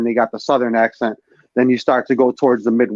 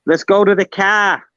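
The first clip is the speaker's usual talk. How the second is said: broad Boston A's, pitched up high; Boston accent; 8 semitones higher than usual. Shown as a demonstration. Friend showing off the Boston accent, broad A's. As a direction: drop the R, flatten the A's like a Bostonian. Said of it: "you just gotta be hard on your A's".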